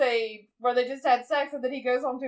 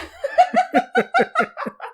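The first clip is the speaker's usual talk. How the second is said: loud high laugh; 6 dB louder than usual. Real, not acted. Laughing at her friend's movie story, loud high laugh.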